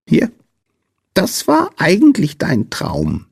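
high piping creature voice; squeaky little man. A narrator's reading. Sandman showing Lina her dream, high squeak. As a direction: squeak it like a tiny sprite. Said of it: "piepste der Sandmann".

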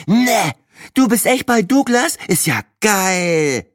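very high, thrilled character voice; excited amazement. Acted. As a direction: gush in disbelief, stretching the last words. Figur staunt begeistert über Douglas. (begeistert staunend, mit sehr hoher Stimme)

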